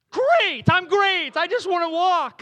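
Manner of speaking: high, loud mock-exasperated reply; impatient shout. Hurried walker answering a stranger who asks back, impatient shout. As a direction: blurt it loudly to get away.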